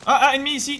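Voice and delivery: voix haute et forte, soudaine; alerte ennemi. Real, not acted. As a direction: signaler l'ennemi d'un coup. Repère un ennemi. (alerte soudaine, voix haute)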